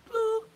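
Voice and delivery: high squeaky boop; a tiny high beep from the register. Retold or imitated. Each item rung up at checkout, a high squeaky boop. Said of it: "put my grapes and shit down like".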